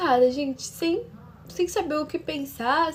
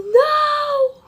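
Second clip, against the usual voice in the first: grito alto e agudo, revivendo o susto; 14 semitones higher, 9 dB louder than usual. Retold or imitated. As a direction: solte um "não" gritado, bem mais agudo. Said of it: "Eu só gritei assim". (grito desesperado de negação)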